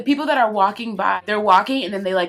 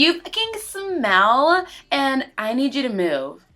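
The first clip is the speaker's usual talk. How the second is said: hammed-up rude complaint; mock rude confrontation; 6 semitones higher, slower than usual. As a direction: play the rude passenger.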